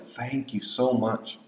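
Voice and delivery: slow, heartfelt, softened thanks; very thankful. Shown as a demonstration. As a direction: stretch it out with real gratitude, leaning on "so". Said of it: "You would be very thankful".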